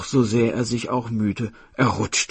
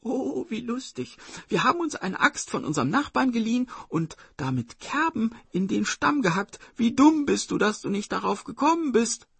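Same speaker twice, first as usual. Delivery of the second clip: put-on high-pitched little girl, teasing; gleeful high sing-out; mocking sister; 13 semitones higher than usual. A narrator's reading. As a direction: taunt the tiger in a high child's voice. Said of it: "rief die Schwester".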